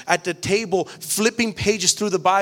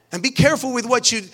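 ordinary talk

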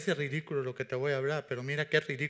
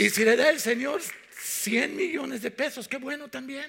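fiery preaching; 10 semitones higher, 7 dB louder than usual. Real, not acted. Preaching at full force.

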